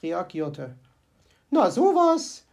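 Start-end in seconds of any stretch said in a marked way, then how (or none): 1.5-2.4 higher, louder puzzled exclaim; surprised at no answer; mild comic astonishment; wondering. Acted. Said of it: "wunderte sie sich".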